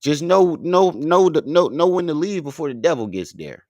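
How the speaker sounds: lightly voiced wise warning; quoting Denzel. Quoting Denzel to friends, mild preachy voice. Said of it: "what'd he say".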